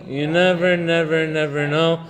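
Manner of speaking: teacher drilling home that you never know; repeated emphasis. Real, not acted. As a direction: lean hard on every "never". Emphatic moral warning.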